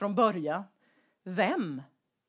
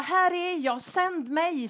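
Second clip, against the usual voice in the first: eager Jesaja; 8 semitones higher, slower than usual. Acted. Jesaja answering God.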